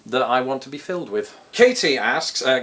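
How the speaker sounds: ordinary delivery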